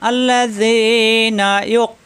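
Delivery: slow, drawn-out chanted recitation; Quran recitation. Acted. Teacher recites Sura Baqara example, slow chanted tajweed. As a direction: intone it slowly like tajweed recitation.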